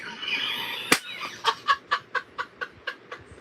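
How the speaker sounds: real, breathy giggling fit; high wheezing laugh. Real, not acted. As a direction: crack up in a long, high wheeze. Cracks up after the line.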